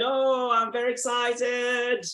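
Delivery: drawn-out, hammed-up show of excitement; slow, put-on thrilled exclamation; mock excitement. Shown as a demonstration. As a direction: stretch it out as if demonstrating excitement. Teacher acts out the phrase's meaning.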